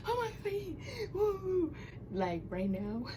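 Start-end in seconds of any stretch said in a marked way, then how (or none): 0.1-1.7 high, drawn-out pained wail, half laughing; mock panic. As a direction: imitate panicking as the wax strip comes. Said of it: "And I'll be like".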